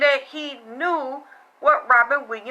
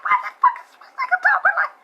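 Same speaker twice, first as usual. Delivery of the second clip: shocked; much faster than usual. Retold or imitated. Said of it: "I'm like".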